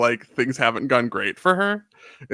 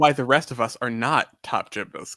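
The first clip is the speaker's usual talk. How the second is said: ordinary talk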